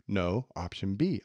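normal talking voice

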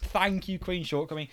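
ordinary delivery

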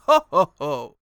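booming Santa ho ho ho; loud jolly Santa laugh. Shown as a demonstration. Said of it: "what most Santas do of actually just saying".

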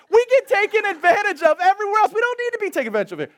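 high, loud, full-force sermon delivery; impassioned preaching. Real, not acted.

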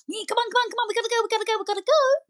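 high, hurried rallying of the team; rallying call. Retold or imitated. Manager rallying staff to get going, high and eager. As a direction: gee everyone up to get moving. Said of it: "calling them to action".

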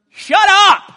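very high, loud comic shout; Jesus yelling shut up. Retold or imitated. Jesus snapping at the wind and waves, comic shout. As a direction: bark it at the storm. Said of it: "And Jesus going".

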